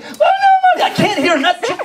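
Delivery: half-laughing mock blubber; blubbering kid. Kid sobs out his excuse to a parent, mock blubbering. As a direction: whimper it like a sobbing kid. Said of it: "Now say it again".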